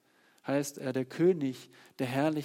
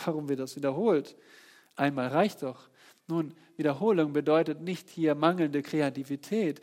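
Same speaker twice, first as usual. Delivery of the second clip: ordinary delivery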